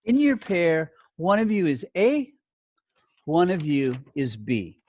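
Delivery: normal talking voice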